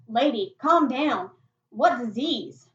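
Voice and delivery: loud, slow plea to calm down; loud calming protest. Acted. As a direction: raise your voice, drawing out the words. Startled boy facing shotgun in story.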